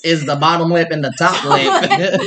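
slow, drawn out through laughter; laughing slow mock explanation. Real, not acted. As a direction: tease him while cracking up. Producer mocking friend's claim, laughing.

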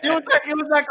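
high, laughing through the words. Real, not acted. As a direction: giggle out the words. Still laughing at the story.

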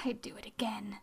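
whispery, low half-laughed admission; near-whisper after a little laugh; hushed aside. Real, not acted. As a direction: drop to a soft whisper, confiding.

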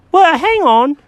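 high, fussy cat voice; Jenkins the cat. Acted.

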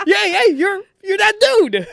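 squealing high and loud, almost crying; starstruck fan. Retold or imitated. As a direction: gush like a dazzled fan. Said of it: "I did the most stupidest".

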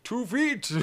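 high-pitched cartoonish quip; short, high comic character voice; mock cartoon character. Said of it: "He's like".

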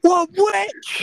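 high, loud shocked squawk; mock shocked shriek. Friend faking villager panic at fire, high shriek. Joking about fire as witchcraft.

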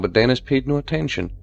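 plain reading voice

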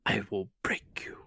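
put-on low, soft Russian-accented menace. Fan quoting a boxer's movie line, Russian accent. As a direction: quote it quietly with a Russian accent. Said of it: "in my Russian accent".

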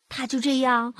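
ordinary delivery, nothing marked

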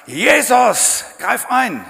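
loud, high cry to Jesus. Retold or imitated. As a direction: scream for help under pressure. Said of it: "dass du schreist". (lauter Hilferuf)

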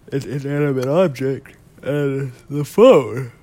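words stretched through a yawn. Real, not acted. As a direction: talk mid-yawn, sleepy. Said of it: "I'm yawning right now".

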